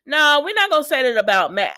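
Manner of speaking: high, loud cutting-herself-off objection; loud protest. Real, not acted. Recapper stopping herself about Maxie's outfit, loud protest. Catches own catty remark, protests.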